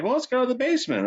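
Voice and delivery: high mocking imitation of her; a squeaky retold suggestion; mock high quote. Friend retold asking to check the basement, high mock voice. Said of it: "She's like".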